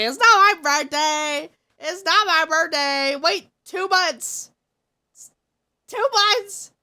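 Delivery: loud, laughing protest; exasperated yell. Real, not acted. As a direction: shout it down, fed up but amused. Protesting to chat.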